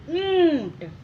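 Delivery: ऊंट with a long nasal "oon"; nasal pronunciation. Shown as a demonstration. Teacher blending the letters, ऊंट drawn out and nasal. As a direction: stretch the vowel of ऊंट through the nose, then "t". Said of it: "ऊपर चांद बिंदु बन गया ऊं".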